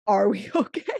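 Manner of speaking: laughing through the question; half laugh, half worried. Retold or imitated. Laughs at her own doubt.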